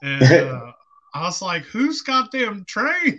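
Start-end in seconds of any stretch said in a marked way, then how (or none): 1.6-3.2 slow, high-pitched incredulous question; mock outraged disbelief. Retelling his reaction to the train noise, mock disbelief. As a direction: stretch it out, voice climbing in mock outrage.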